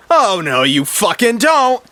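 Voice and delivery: high, loud threat with a dragged ending; acted angry defiance; defiant. A narrator's reading. Martha stops her would-be killer.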